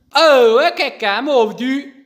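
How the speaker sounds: sudden loud annoyed cry; loud frustrated gamer shout; frustrated outburst. Real, not acted. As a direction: yell at the game as it goes wrong. Suddenly killed in game.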